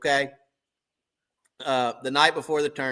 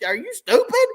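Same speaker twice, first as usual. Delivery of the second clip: much higher, louder incredulous squeal; mock disbelief; 5 dB louder than usual. Retold insult to fishing partner.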